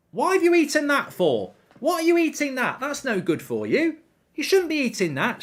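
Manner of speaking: high-pitched imitation of someone telling off a pet; mock scolding dog owner. Owner fussing at the dog that ate garlic, mock high-pitched scolding. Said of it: "And she, so she goes".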